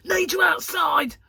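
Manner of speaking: imitating Gary's sudden shout, slow and loud; mock urgent shout. Husband bursting in calling wife outside, urgent shout. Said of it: "he goes".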